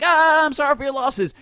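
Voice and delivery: high, loud overdone sorry; hammed-up passionate apology. Kid trying to sound passionate for mom, hammed-up. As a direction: throw fake passion at it. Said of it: "No, with passion".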